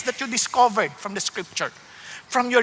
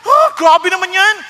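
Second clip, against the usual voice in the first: loud, high mock protest; quick to anger; 6 semitones higher, 8 dB louder than usual. Imitating churchgoers angered by the word, loud indignation. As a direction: object indignantly, drawing it out. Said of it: "Yung iba nagagalit agad".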